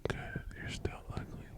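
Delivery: hushed, deadpan tease; soft voiceless ribbing; whispered podcast voice. Shown as a demonstration. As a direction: whisper the insult like a podcast host. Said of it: "Talk like this".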